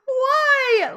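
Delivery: high, loud, whiny cry of embarrassment; hammed-up despairing wail; mock wail. Vlogger embarrassed by the popular video, mock wail.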